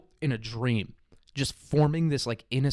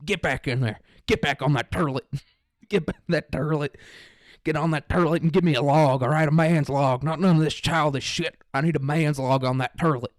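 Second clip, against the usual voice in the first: gruff country father giving orders, a bit raised; stern country dad; 3 semitones higher, 4 dB louder than usual. Retold or imitated. Dad to his kid on the toilet, stern country orders.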